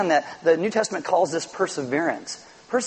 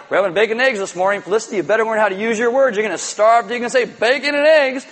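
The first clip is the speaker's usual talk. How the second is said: mock strict parent; 5 semitones higher, 5 dB louder than usual. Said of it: "do you think they're like".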